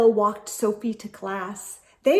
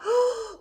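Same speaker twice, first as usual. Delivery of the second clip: sharp high gasp; a sudden startled gasp; 11 semitones higher than usual. Acted.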